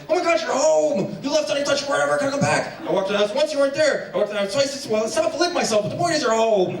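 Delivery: high, breathless puppy voice, gushing nonstop; a dog losing its mind with joy at the door; overjoyed dog. Pretend. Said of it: "the dog is like".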